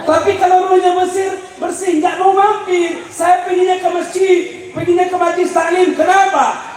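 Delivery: high, driving preacher's delivery; pressing sermon, pitched high; forceful preaching. Real, not acted.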